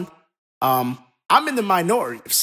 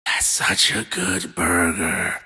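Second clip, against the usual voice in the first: low, slow mock amazed voice; a lightly voiced awed burger eater; imitated listener; 11 semitones lower, 4 dB quieter, slower than usual. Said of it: "and you're like".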